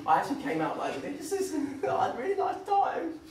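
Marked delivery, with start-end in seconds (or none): 1.0-3.2 very high, strained, laughing put-on voice; mock forced cheer. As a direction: stretch it out high with fake delight. Mocks forced happy time.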